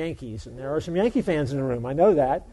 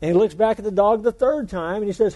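normal talking voice

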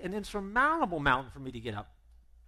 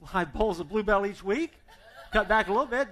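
louder, laughing confession; sheepish laugh; 4 semitones higher, 8 dB louder than usual. Acted. Jeff laughing as he admits eating ice cream.

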